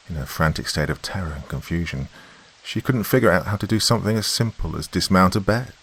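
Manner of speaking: ordinary delivery, nothing marked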